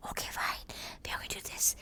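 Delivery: muffled whisper behind hand. Shown as a demonstration. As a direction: whisper as if hiding. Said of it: "you speak like this in, in muffled voices".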